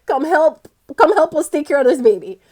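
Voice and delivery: laughing, loud and high, calling the family over; calling for help. Acted. To cousins and aunties, calling them over loudly. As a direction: shout to the aunties to come help.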